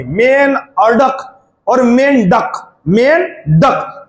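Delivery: मेन अडक against मेन डक, both very slow; teacher contrasting the wrong and right sound of मेंढक; slow syllable contrast. Shown as a demonstration. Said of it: "तो डक की आवाज निकलेगी".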